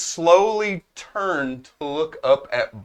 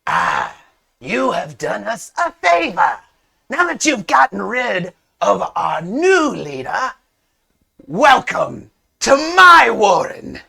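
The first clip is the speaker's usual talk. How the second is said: high, drawn-out kobold proclamation; boastful kobold; 6 semitones higher than usual. Acted. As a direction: crow it like a creature's new leader. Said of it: "the kobold with the spear out says".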